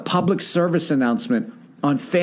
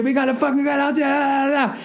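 mock hype yell; 8 semitones higher, faster than usual. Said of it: "He yelled some shit".

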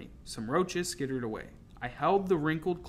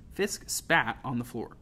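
plain reading voice

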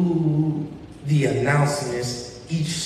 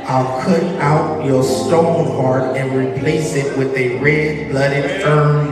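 building preaching voice; 5 dB louder than usual. Acted. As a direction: preach it with rising fire. Reads scripture with rising force.

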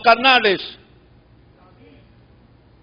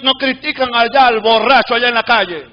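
normal talking voice

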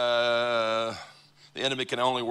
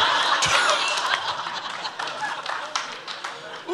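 high laugh. Real, not acted. Bursts out laughing.

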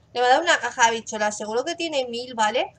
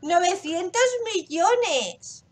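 very slow, higher, drawn out in awe; stunned awe; 7 semitones higher, 4 dB louder, much slower than usual. Real, not acted. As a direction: savour the huge number slowly. Repeating the number in awe.

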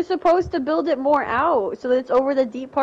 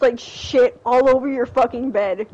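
usual speaking voice

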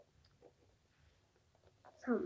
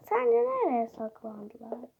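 ordinary talk

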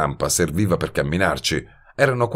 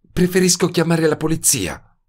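higher female voice, worried; Betty's voice; 11 semitones higher than usual. A narrator's reading. (voce femminile più acuta, preoccupata)

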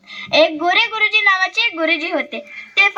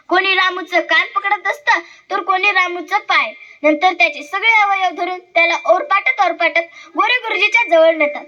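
plain reading voice

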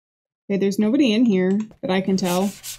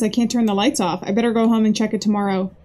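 ordinary talk, nothing marked

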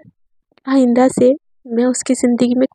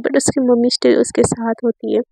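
ordinary delivery

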